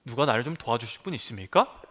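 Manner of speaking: politely genteel. Pretend. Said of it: "점잖게".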